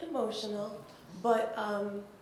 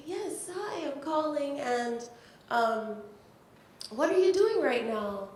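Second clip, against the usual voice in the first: higher, cheerful imitation of the caller; imitating Rita; 5 semitones higher, 3 dB louder than usual. Retelling how Rita Dove asked what she was doing, higher cheerful imitation. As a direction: pitch it up, lightly playing the professor. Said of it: "she's like".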